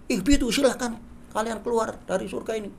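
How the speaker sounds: mock angry command. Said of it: "Itu Allah kan marah itu".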